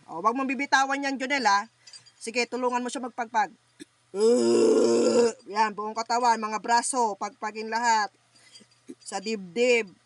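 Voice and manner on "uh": loud groaning grunts. Real, not acted. Healer grunting loudly over the patient in trance. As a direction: push out each grunt from the chest.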